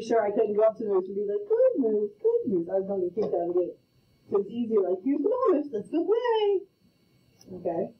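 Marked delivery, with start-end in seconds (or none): 1.5-2.1 higher, louder petting coo; coaxing moose. Pretend. Player pretending to calm the tall opponent, coaxing coo. 5.0-6.6 high, sweet coax to an animal; coaxing pet talk. Pretend. Player pretending to hand the tall opponent the ball, high coaxing pet talk.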